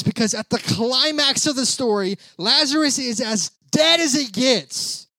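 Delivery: high-pitched, emphatic sermon delivery; fired-up preacher retelling a Bible story; impassioned preaching. Real, not acted.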